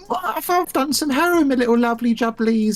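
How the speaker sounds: very high-pitched mock comic patter; hammed-up celebrity impression, very high voice; Russell Brand. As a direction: squeal out florid, whimsical nonsense in a high caricature. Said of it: "like, Russell Brand getting on and going".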